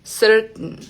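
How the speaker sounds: "certain" caught in the throat at the T; glottal T. Shown as a demonstration. Teacher repeating the example for learners, glottal T. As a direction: end "certain" on a nasal n with no vowel. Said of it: "Se detiene acá en la garganta".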